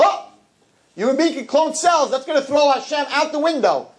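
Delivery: high-pitched mock amazement; excitable objector. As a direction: mimic a gullible person, voice way up high. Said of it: "So some people".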